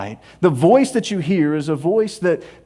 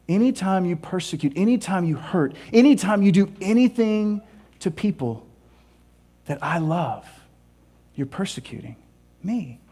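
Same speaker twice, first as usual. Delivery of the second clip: soft tender voice of Jesus; 3 dB quieter than usual. Acted. Jesus answers Saul tenderly.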